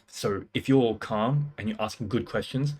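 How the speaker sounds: ordinary talk, nothing marked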